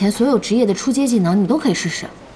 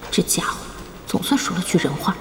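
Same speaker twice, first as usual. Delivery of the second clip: muttered aside; 5 semitones lower, 6 dB quieter than usual. Acted. Muttered aside about rival.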